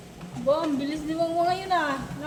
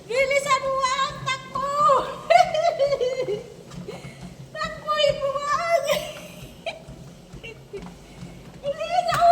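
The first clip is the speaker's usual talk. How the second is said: high, giggling calls; laughing excitedly; 7 semitones higher than usual. Real, not acted. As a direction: laugh through the cheering. Laughing while urging the pet.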